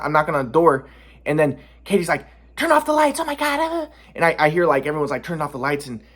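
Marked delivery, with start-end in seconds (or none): 2.6-3.9 high frantic imitation; panicked Katie. Katie panicking at the knock, high mock voice.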